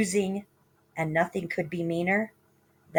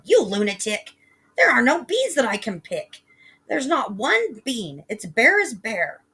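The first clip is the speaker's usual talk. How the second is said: loud, higher scolding; angry mother; 5 semitones higher, 5 dB louder than usual. Acted. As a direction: scold the silly boy. Mother angrily denying beans.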